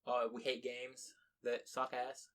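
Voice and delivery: ordinary talk, nothing marked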